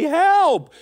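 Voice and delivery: loud, high-pitched yell; acted cry for help. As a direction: call out as if caught in a storm. Disciples cry to Jesus in storm.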